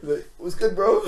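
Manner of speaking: mock cracked-voice greeting; voice breaking. Man greeting a looming stranger at his car window, voice breaking. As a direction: act out a nervous voice break. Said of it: "Literally, my voice broke".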